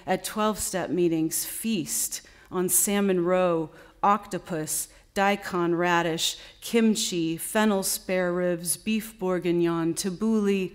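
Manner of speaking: deliberate recital, each phrase set apart; poem reading. Acted. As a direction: recite it to an audience, firm onsets falling away. Reads her second poem aloud.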